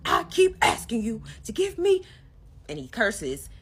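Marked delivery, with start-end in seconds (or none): 0.1-2.0 high, loud imitated yell; a husband's mimicked shout; retold yell. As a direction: belt it out like him yelling at her. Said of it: "then he starts yelling".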